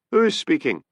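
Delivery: high, demanding question. A narrator's reading. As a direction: insist on knowing who's calling. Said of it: "demanded Hamish".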